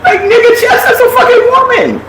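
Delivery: words breaking into high laughter; cracking up while talking; laughing hard. Real, not acted. Laughing hard at own joke.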